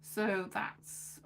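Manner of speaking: ordinary talk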